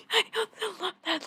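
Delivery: very high, loud giggly aside; amused, laughing. Real, not acted. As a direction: squeal it through real amusement. Amused aside after reading.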